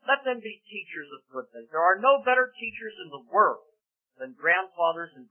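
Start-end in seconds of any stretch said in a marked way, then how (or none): none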